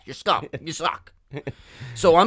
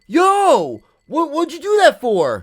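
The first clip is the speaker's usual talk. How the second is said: much higher and louder mock outrage; indignant stranger; 10 semitones higher, 5 dB louder, slower than usual. Angry table players confronting him, high shrill mock outrage. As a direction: yelp it like they caught you cheating. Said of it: "They went this".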